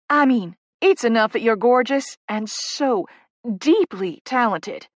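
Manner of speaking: "so deeply talented" drawn out, each word weighted; emphasizing each word. A narrator's reading. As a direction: gush slowly, leaning on every word of "so deeply talented". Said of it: "emphasizing each word".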